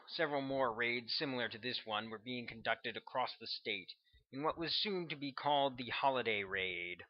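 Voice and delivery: plain reading voice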